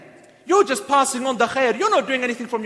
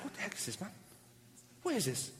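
much softer, muttered annoyance to himself; irritated under-breath mutter; 3 semitones lower, 18 dB quieter than usual. Retold or imitated. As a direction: grumble it under your breath. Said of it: "this guy's like".

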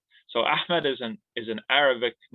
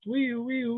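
high, playful siren noise; police siren; 13 semitones higher than usual. Pretend. Mock police siren again.

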